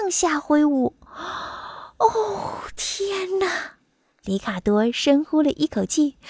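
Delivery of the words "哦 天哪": high, awed little exclamation; amazed. Acted. Butterfly tries his wings, high and amazed. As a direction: exclaim in high-pitched wonder.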